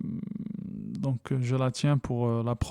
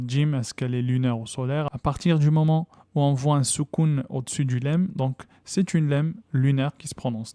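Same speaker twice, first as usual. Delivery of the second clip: normal talking voice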